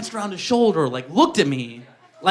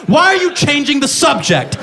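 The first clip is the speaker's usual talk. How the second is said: mock indignant; 7 semitones higher than usual. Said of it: "I'm like".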